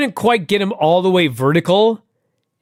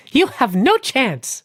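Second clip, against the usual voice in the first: high, slow cutesy imitation; cutest voice; 7 semitones higher, slower than usual. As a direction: put on a cute voice, drawing it out. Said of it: "in the cutest voice".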